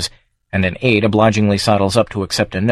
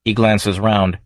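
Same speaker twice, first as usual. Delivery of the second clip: ordinary delivery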